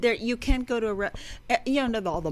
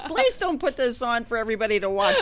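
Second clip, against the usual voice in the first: high, loud, fretful self-critic; inner critic voice; 11 semitones higher, 6 dB louder than usual. Retold or imitated. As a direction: plead like a fussy perfectionist. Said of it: "this professional part of me still that goes".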